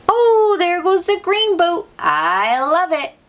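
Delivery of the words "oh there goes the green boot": high, bright exclamation; excited reveal. Acted. Teacher excitedly showing the children the green boot. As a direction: greet the boot with delight.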